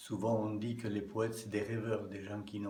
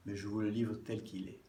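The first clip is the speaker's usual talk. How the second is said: ordinary talk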